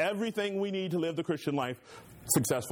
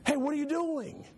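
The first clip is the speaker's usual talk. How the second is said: surprised homeowner; 13 semitones higher than usual. Retold or imitated. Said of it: "I open my door and I say".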